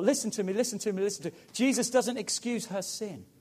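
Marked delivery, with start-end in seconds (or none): none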